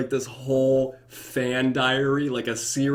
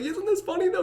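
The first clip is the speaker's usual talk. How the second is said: much higher, giddy and pleased; gleeful high voice. Pretend. Ghost asking God if the joke is funny, giddy and high. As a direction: tease it up high like a kid. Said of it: "And I'd be like".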